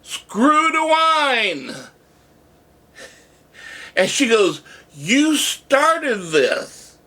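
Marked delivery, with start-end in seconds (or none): 0.0-1.9 very high, long-held answering cry; retold group yell. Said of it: "yelled back at him". 4.9-7.0 high, very drawn-out exclamation; slow, lifted, emphatic reveal; retold amazement. Waitress acted crediting him.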